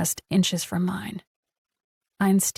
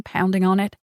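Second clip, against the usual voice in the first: ordinary delivery, nothing marked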